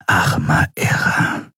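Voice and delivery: slow, voiceless murmur of disbelief; stunned whisper. A narrator's reading. Companion looks at the dead cultist, whispering aghast. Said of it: "flüsterte Shiar entgeistert".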